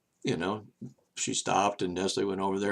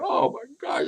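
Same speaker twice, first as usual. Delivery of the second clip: much higher, altered voice of disgust; imitated shocked exclamation. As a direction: gasp it like a stranger horrified. Strangers leaving the revolving door.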